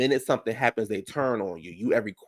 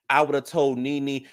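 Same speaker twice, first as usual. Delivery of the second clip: ordinary talk, nothing marked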